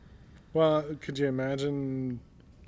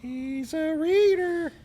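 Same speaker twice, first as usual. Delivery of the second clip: drawn-out squeaky voice, slow; high silly voice; 13 semitones higher, much slower than usual. Pretend. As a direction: stretch it out in a high, goofy voice. Repeats line in put-on voice.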